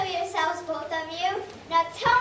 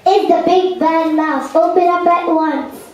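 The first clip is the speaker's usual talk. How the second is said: loud villain demand; 2 semitones lower, 12 dB louder than usual. Acted. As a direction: roar it like a bully at the door. Villain mouse demands entry.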